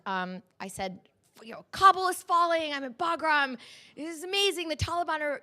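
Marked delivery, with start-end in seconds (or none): 1.4-5.4 much higher and louder, rushing with excitement; young reporter pitching a magazine editor, high and thrilled; eager pitch. Retold or imitated. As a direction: sell it breathlessly to a busy editor.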